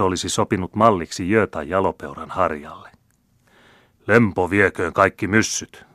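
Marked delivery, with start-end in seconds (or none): 4.1-5.9 loud blunt curse; captain's shouted oath. A narrator's reading. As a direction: blurt it out like a hot-headed captain. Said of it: "huusi muuan punaverinen kapteeni".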